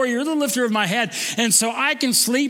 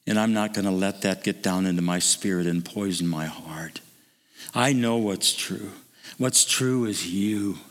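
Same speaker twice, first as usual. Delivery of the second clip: guided meditation; 12 semitones lower, 3 dB quieter than usual. Acted. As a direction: lead them gently into quiet reflection. Closing devotional meditation.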